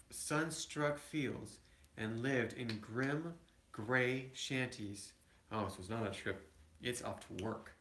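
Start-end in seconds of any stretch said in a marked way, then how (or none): none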